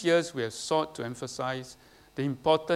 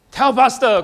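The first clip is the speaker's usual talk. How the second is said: loud call-out; 8 semitones higher, 13 dB louder than usual. Shown as a demonstration. Pastor answers his own question to the church, loud and high. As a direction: shout TELL PASTOR, louder and higher. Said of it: "what do you do?".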